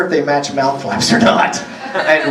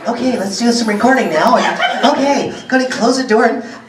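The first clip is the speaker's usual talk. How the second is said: high, childish mock voice of a colleague; squeaky little-girl take on a friend; hammed-up high-pitched imitation; impression of Vic; 9 semitones higher than usual. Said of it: "That's my impression of Vic".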